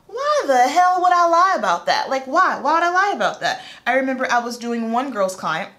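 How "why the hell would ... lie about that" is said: loud, high-pitched exasperation; fired-up disbelief at being doubted; indignant retort. Retold or imitated. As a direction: demand it, incredulous, pitch jumping up.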